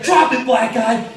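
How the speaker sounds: high-pitched mock command; shouted command. Gunman confronting a homeless man, acted shouted command. Armed driver's order, repeated.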